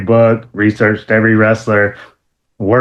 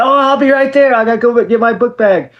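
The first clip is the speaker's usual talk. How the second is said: higher, hurried; eager call; 13 semitones higher than usual. Retold or imitated. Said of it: "So I said".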